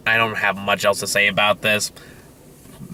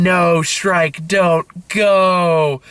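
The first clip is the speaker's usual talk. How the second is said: mock weeping children; 6 semitones higher, 3 dB louder, much slower than usual. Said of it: "children weeping in chairs saying".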